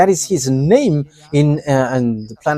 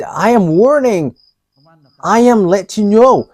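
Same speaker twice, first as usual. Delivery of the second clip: stern warning, a little louder; retold angry warning; 4 semitones higher, 4 dB louder than usual. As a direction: imitate an angry threat, half in earnest. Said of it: "he's with great anger".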